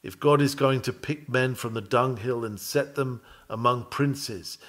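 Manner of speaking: ordinary talk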